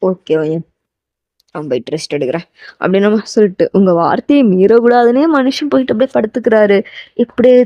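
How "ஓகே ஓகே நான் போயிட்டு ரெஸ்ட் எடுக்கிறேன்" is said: low, soft, giving in; resigned low man's voice; voiced male lead. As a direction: agree quietly in his deeper voice. Taeyang gives in, voiced low.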